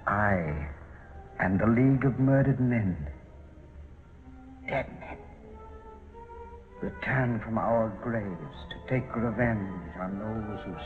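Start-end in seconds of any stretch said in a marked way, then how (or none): 4.7-5.2 eerie whisper. Acted. Villain's broadcast, breathy aside.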